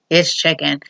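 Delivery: male voice. Retold or imitated. Disguised wife serving chicken at the party, in a lightly put-on male voice. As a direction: drop it slightly into a man's voice. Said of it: "in the male voice".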